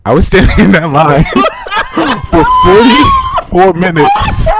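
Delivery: real laughter through the words; laughing. Real, not acted. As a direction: crack up while telling it.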